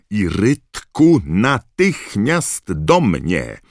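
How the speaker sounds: loud, high, very drawn-out order; angry snarl. A narrator's reading. Professor summons the poltergeist, snarling loudly and slowly. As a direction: summon them slowly and furiously. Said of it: "warknęła profesor McGonagall".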